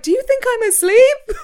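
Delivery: high, loud squeal of disbelief, laughing; incredulous yelp that tips into laughter; mock exasperation. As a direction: protest in a shrieky comic outburst. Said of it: "And I'm like".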